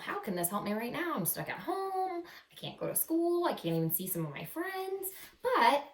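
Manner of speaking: ordinary talk, nothing marked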